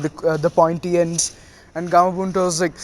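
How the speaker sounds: plain reading voice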